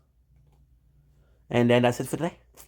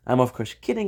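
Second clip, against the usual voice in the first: ordinary delivery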